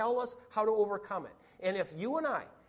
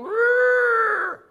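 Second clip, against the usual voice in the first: long, high trumpeting elephant roar; 13 semitones higher, 14 dB louder than usual. Acted. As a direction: blast out a loud elephant cry.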